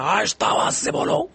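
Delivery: high, strained imitation of a shouted order; mock yelling. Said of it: "چیخ کے اس کو سمجھا رہے ہیں".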